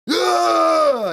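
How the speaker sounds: high, loud drawn-out "ah" yell; mock scream that won't fully let go; held-back yell. Shown as a demonstration. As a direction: yell like you're holding back. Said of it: "impression of someone if you tell them to yell".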